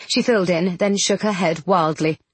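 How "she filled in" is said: ordinary delivery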